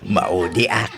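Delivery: said through a low chuckle; laughing. Acted. Husband teasing his wife that he'll come last, laughing. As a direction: tease her while laughing.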